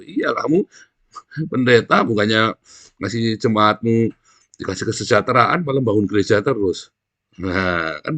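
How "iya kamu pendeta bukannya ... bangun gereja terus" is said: loud, forceful rebuke from the pulpit; fiery preaching. Acted. Preacher rebuking pastors before the congregation, loud preaching. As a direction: preach it hard at the crowd.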